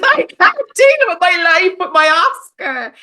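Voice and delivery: high, loud, tearful acceptance speech; Gwyneth Paltrow Oscar speech. Retold or imitated. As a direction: gush it like a weepy Oscar winner. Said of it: "maybe pull a Gwyneth".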